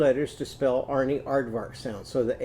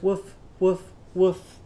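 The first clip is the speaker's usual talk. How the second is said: mock barking, a bit higher; puppy bark; 5 semitones higher, 4 dB louder, slower than usual. Shown as a demonstration. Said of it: "It would sound like this".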